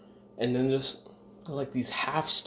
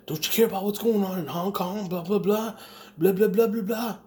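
high-pitched mocking imitation of a parent; nagging dad; 9 semitones higher, 3 dB louder than usual. Dad arguing with him about Hong Kong, nagging. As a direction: mimic a worked-up father, pitched way up.

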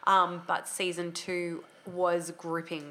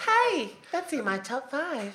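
slow voiceover read; 5 semitones higher, slower than usual. Shown as a demonstration. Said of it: "my turn".

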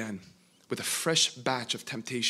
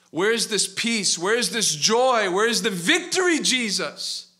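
louder, higher, slow anguished cry; desperate plea; 8 semitones higher, 8 dB louder, much slower than usual. Acted. Exhausted man crying out to Jesus for peace, anguished. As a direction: drag out each question, worn out and pleading. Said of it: "this man is weeping".

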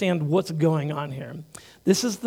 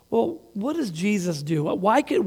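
normal talking voice

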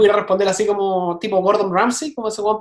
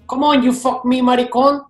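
Tony Montana; 4 semitones higher, 4 dB louder, slower than usual. Retold or imitated. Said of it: "Tony Montana decía".

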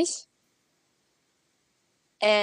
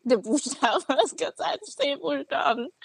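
laughing while parroting the question back; amused mock repeat of a silly question; mocking echo through laughter; 4 semitones higher than usual. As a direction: repeat the odd wording, giggling at it. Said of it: "Das ergibt keinen Sinn".

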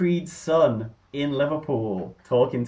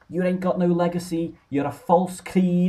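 imitating Scouse accent; 8 semitones higher than usual. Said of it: "big Scouse accent".

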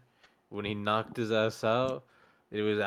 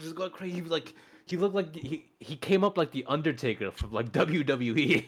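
hyped, higher, laughing bro recap; frat bro; 5 semitones higher, 4 dB louder than usual. Real, not acted. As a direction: gush about the comeback like a frat bro. Hyped retelling of fight comeback.

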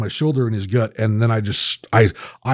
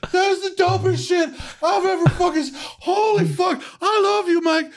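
very high, laughing squeaky voice; hammed-up giddy friend; giddy Ian. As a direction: gush like a delighted drunk friend. Ian's giddy reaction at stairs.

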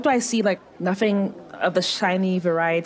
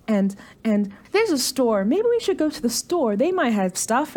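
ordinary talk

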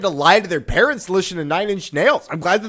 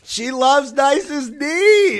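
mock mom; 7 semitones higher, much slower than usual. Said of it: "mocking the fake band name".

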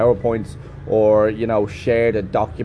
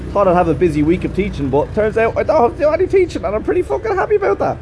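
gleeful rising voice; 10 semitones higher, faster than usual. Real, not acted. Vlogger gleefully telling viewers he has no teaching, voice climbing. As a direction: pitch climbing higher and higher with glee.

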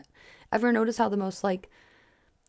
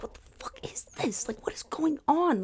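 acted-out whispered disbelief; high, hushed mock exasperation; exasperated whisper; 8 semitones higher, 5 dB quieter than usual. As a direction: whisper it as if despairing to yourself. Re-enacting exasperation when in a rut.

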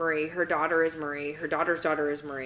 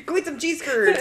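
loud, higher mock Wisconsin accent; playful Wisconsin imitation, pitched up; 8 semitones higher, 6 dB louder than usual. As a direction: joke in a hammed-up Wisconsin accent. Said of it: "from Wisconsin, so he had an accent".

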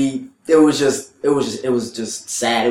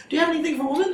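customer lady; 3 dB quieter, much faster than usual. Retold or imitated. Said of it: "She goes".

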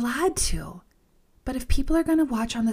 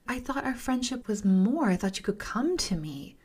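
retold disbelief; 4 semitones higher than usual. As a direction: ask it as if betrayed by friends. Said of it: "And I'm like".

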